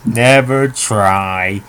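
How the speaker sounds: long, drawn-out mock moral; drawn-out catchphrase. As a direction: quote it very slowly, stretching both words. Repeats the Simpsons catchphrase.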